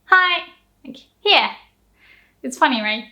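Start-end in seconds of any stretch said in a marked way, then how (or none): 0.0-1.5 high, bright Hi, hi; casual handing over. Shown as a demonstration. Teacher acting out a casual handover to a friend. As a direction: toss off a friendly Hi, hi while handing something over. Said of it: "we actually say".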